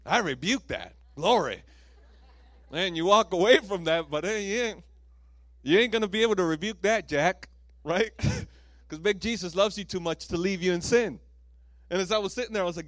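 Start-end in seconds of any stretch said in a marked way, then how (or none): 0.1-1.6 mock rebuke. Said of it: "Thinking it's the devil". 2.7-11.2 loud, fired-up preaching; fired-up preacher shouting the point; preaching hard. Real, not acted. Preaching at full force.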